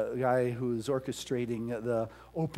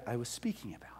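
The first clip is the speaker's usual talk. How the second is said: a soft breathy whisper; whispered aside; 3 semitones lower, 11 dB quieter, faster than usual. Real, not acted. Aside within sermon.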